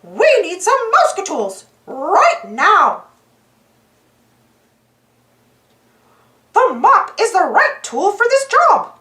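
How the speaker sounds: high, cheerful mouse voice; bright and eager, like a cartoon hero; Mickey voice. A narrator's reading. Mickey voice in story.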